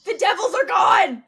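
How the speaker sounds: loud, high cheer; cheering that the Devils are out; gleeful shout. Real, not acted. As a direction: crow it out loud. Celebrating rival team eliminated.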